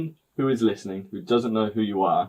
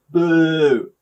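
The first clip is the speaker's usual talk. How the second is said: a playful jeering boo, higher and louder; mock boo; 6 semitones higher, 7 dB louder than usual. Host teasing architects on his podcast with a mock boo.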